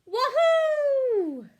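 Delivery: very high, loud joyful wahoo; gleeful whoop. A narrator's reading. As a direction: whoop with delight on the ride. Duck thrilled with the ride.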